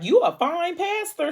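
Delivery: high-pitched playful flirting; joking flirtation. Retold or imitated. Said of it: "Arya's way of trying to ease the situation".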